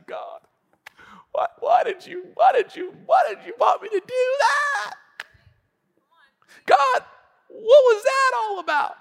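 put-on very high, childish wail; hurt complainer. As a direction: protest to God like a wailing kid. Hurt believer wailing at God like child.

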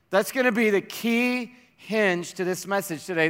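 usual speaking voice

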